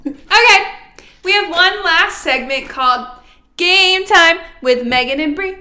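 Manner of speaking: loud, hyped segment intro; game show announcer. Acted.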